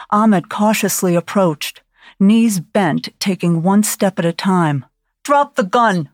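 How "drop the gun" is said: yelled. A narrator's reading. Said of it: "he yelled".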